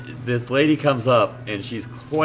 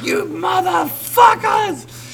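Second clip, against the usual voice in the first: much higher, slow stretched-out yell; mock scream; much slower than usual. Imitating the arrested preacher lady screaming at the store. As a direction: screech it like a woman being dragged off. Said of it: "she's like".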